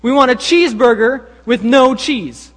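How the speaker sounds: high, loud, emphatic demand; mock insistence. Prank customers demand their order from cashier, loudly insistent. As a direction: insist loudly with hammed-up indignation.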